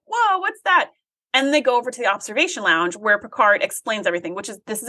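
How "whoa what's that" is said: high, amazed gasp; wowed. Retold or imitated. Viewer amazed by the glowing cube, high and wondering. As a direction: marvel at it, pitched high. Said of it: "and you're like".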